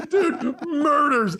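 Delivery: breathless with laughter; laughing hard. Real, not acted. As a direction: crack up mid-word. Crying with laughter.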